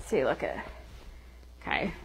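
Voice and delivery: usual speaking voice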